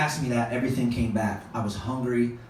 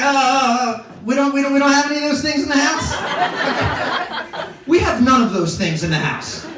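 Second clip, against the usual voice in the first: flustered panic; 9 semitones higher, 3 dB louder than usual. Retold or imitated. Panicked stoned reply.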